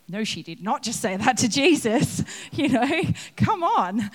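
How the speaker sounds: indignant outburst. Acted. Listener objecting to Martha's words, mock outrage. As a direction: play the shocked onlooker. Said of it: "the temptation there is to go".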